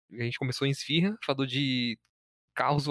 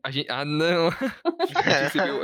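laughing; 5 semitones higher, 7 dB louder than usual. Real, not acted. Laughs at own joke.

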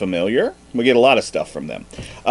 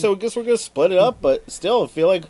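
put-on high, quick sly boy voice; lying kid; 9 semitones higher than usual. The boy telling his seven friends he got only fifty bucks, high sly voice.

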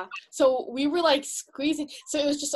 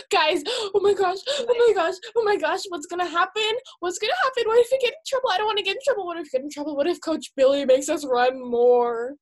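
distraught, retold; 6 semitones higher than usual. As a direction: replay a spiralling meltdown to friends. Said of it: "I was getting really distraught".

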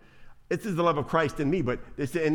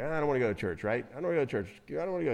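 mock sulky pastor; 4 semitones lower, 6 dB quieter, much faster than usual. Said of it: "he's mad, he's like".

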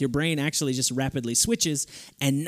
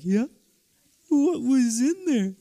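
high, drawn-out eager dog voice; talking dog; 9 semitones higher, slower than usual. Retold or imitated. Dog asking owner what's in the fridge, high and eager. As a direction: voice a dog hanging on every word. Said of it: "'cause he's like".

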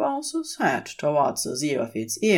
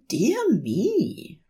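much higher, delighted angel voice; Aziraphale; 7 semitones higher than usual. A narrator's reading. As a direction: greet him with fussy delight. Aziraphale at the term.

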